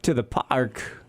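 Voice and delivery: usual speaking voice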